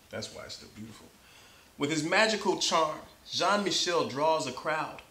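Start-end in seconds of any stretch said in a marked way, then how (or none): 0.1-1.1 breathy laughing aside, fast; quick hushed laughing aside; laughing whisper. Real, not acted. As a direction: giggle it out under your breath. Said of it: "laughs while speaking".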